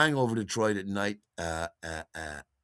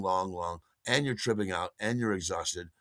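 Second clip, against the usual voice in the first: ordinary delivery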